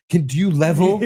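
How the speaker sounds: lightly voiced Artie Bucco; a hint of Artie Bucco. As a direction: ask it like a slick little restaurateur. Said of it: "Artie Bucco all of a sudden".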